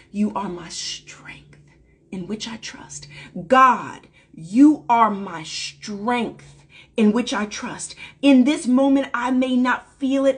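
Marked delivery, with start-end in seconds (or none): 0.1-3.1 whispered affirmation, said to oneself; whispered mantra. Shown as a demonstration. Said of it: "a mantra and an affirmation I speak to myself". 3.5-8.0 the mantra said aloud, slow and deliberate; spoken aloud. Shown as a demonstration. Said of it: "a mantra and an affirmation I speak to myself".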